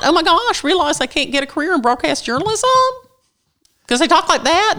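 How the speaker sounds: put-on high-pitched Valley Girl uptalk; high, girlish questioning lilt; Valley Girl speak. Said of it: "that kind of Valley girl speak".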